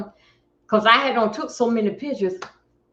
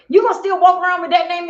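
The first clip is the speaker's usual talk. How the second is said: much higher and louder, pushing her; retold nagging; 9 semitones higher, 8 dB louder, faster than usual. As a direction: nag her high and loud. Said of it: "I kept saying".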